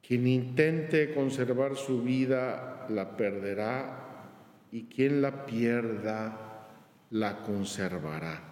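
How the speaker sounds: slow, low solemn reading; solemn scripture quote. Acted. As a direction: weigh each clause slowly.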